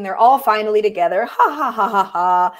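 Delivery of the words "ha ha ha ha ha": acted "ha ha" laugh; storybook laughter. A narrator's reading. Campers laughing together around the campfire, acted laugh. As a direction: read out a mock laugh. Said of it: "sitting around the campfire and laughing".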